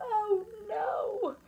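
high, drawn-out mock moan; woeful, rising groan; moaning dismay. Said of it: "we moaned".

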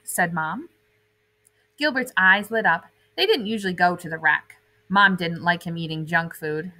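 put-on lively read-aloud for kids; animated children's story narration; storybook reading. A narrator's reading. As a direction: read it like a bedtime picture book. Reading picture book to children.

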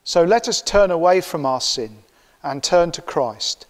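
normal talking voice

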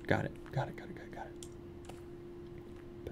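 soft unvoiced whisper. Real, not acted. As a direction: whisper it to yourself. Sneaking through dark area.

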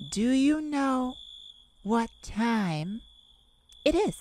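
slow, spaced scolding question, rising to the end; pointed question. Acted. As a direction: drag each word out like a stern roommate. Scolding friend for coming late.

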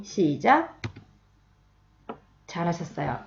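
normal talking voice

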